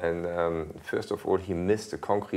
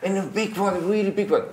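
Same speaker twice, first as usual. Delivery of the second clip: higher, louder, urgent radio report; excited radio call; 12 semitones higher, 3 dB louder than usual. Retold or imitated. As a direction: call it in like a frantic team radio. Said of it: "He said".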